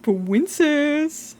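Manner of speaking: mocking chat's cooing again; mock sweet-talk. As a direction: coo it like a flirt. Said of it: "slap of the feet together".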